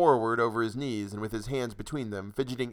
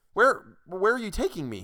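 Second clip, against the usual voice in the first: panicked; 10 semitones higher than usual. Acted. Carried off.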